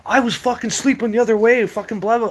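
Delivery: imitating a panicked buddy, high and loud; high-pitched, agitated retelling of his protest; freaked out. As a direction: act out a rattled friend just woken up. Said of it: "He's all freaked out".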